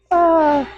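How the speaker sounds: high strained gasp; effort groan. Real, not acted. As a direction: let the strain out. Exertion between sets.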